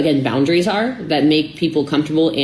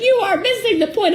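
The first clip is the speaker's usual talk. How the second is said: mock boyfriend. Quoting boyfriend, very high.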